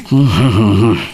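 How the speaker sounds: a single amused chuckle; short laugh. Acted. Father amused by his daughter's suspicion, brief chuckle. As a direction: laugh it off lightly.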